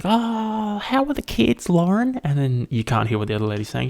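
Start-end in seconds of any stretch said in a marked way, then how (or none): 0.0-2.2 high, chirpy imitation of a lady on speakerphone; squeaky put-on lady voice, much higher; overheard phone caller. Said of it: "It's always like".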